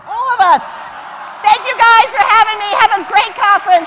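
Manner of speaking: high, loud rally cry; loud cheering finish. Real, not acted. Speaker thanks the conference crowd with a loud cheer. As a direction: whip the crowd up.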